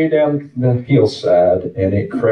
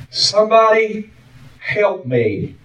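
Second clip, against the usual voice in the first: "somebody" pitched high, then dropping off; faint cry for help; 9 semitones higher, slower than usual. Retold or imitated. Stranded man pleads to passing jogger, faint cry. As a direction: mimic a weak, drawn-out call from far down. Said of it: "He could hear a faint cry".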